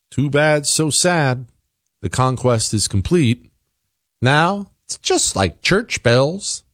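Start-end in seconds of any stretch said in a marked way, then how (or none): 0.1-1.5 higher-pitched, taunting pity; mock sympathy. Mocks listeners uneasy about prayer call. 4.2-6.6 high, slow mock reassurance; mocking echo. Mockingly echoes police chief's remark.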